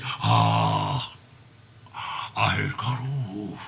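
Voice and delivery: low, very slow and soft; king sighing at his own reflection; mournful sigh. Acted. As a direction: let the age sink in, drawn out. Lear sees himself in the glass.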